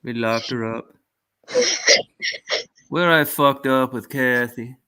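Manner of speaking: ordinary talk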